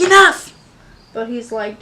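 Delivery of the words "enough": loud, high "Enough!" shout. Retold or imitated. Jethro stops the fighting with a loud shout. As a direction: cut the chaos with one yell. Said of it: "Jethro had to be like".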